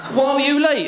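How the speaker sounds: high, loud interrogation; hammed-up cross questioning; exasperated demand. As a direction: demand an excuse, pitch and volume up. Acted quarrel, latecomer questioned.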